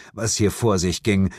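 ordinary delivery

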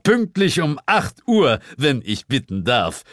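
high-pitched, formal boss; Sir James. A narrator's reading. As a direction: order a report with pointed politeness. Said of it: "erklang Sir James' Stimme unter mir".

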